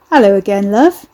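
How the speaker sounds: leer it like a Cockney chancer; loud, suggestive Cockney greeting; passable Cockney accent. A narrator's reading. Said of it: "he leered in a passable Cockney accent".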